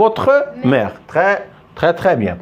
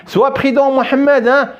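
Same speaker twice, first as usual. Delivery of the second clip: a father calling out a warning, pitched up; warning his son; 9 semitones higher than usual. Retold or imitated. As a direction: call the boy's name as a caring warning. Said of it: "je dis à mon fils". (un père qui avertit son fils en criant un peu)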